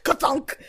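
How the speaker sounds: mock stapler clunk; heavy clunk. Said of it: "be like".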